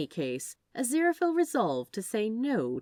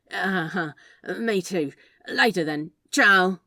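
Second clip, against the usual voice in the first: flustered; 9 dB louder than usual. Acted. Awkward flustered goodbye.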